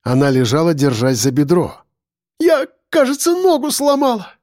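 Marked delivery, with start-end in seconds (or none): 2.4-4.3 high-pitched, strained wail; pained moan. A narrator's reading. Яна telling Максим of her injury, pained moan. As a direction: moan it high through pain. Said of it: "простонала она".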